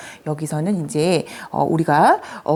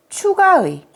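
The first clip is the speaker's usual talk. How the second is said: usual speaking voice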